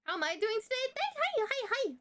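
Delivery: very high, flustered squeaky hellos; real high-pitched burst of greeting; overwhelmed greeting. Real, not acted. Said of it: "Sorry, I got overwhelmed there".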